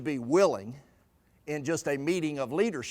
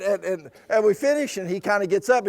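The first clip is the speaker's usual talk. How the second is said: normal talking voice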